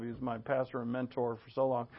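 normal talking voice

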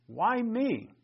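Why me? rising high on me; emphasis on me. Shown as a demonstration. Preacher voicing Christ's question with stress on me. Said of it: "I'm using voice inflection to emphasize".

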